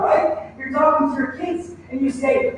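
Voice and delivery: normal talking voice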